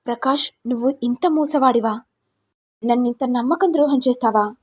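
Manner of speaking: plain reading voice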